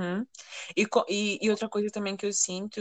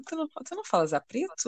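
higher-pitched, incredulous imitation; mock surprised question; 6 semitones higher, 3 dB quieter than usual. Imitating someone expecting her to talk differently, mock surprise. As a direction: ask it as if puzzled, pitch rising at the end.

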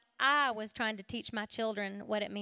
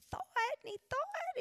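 put-on childlike pondering; 13 semitones higher, 6 dB quieter than usual. Mimics small boy pondering.

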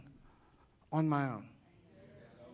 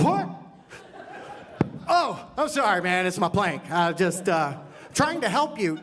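clueless plank guy; 2 semitones higher, 5 dB louder than usual. Acted. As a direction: play the guy who doesn't notice the plank. Acts himself whacking with plank.

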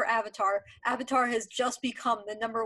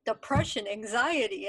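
ordinary talk

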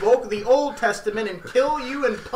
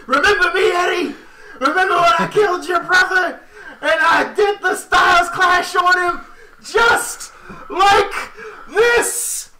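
high, loud, laughing villain taunt; 9 semitones higher, 4 dB louder than usual. Retold or imitated. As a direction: cackle it like a cartoon villain. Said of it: "He's gonna be like".

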